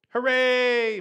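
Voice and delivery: high, loud jubilant cheer. A narrator's reading. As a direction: burst out with joy. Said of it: "my brother cheered".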